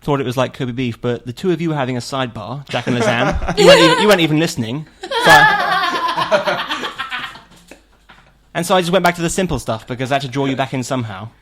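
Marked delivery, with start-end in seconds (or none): 3.6-11.3 laughing through the words. Real, not acted. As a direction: protest while cracking up. Laughing while protesting.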